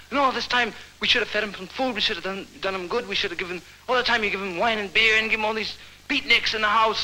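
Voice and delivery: high, rapid-fire complaint; acted nagging. Woman complaining about feeding beatniks, nagging scold.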